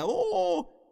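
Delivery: put-on piercing high "Oh" of a frightened child; a boy crying out high at his brother's broken arm; high anguished cry. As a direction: let out a boy's high wail of alarm. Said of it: "Yo estoy gritando ahí".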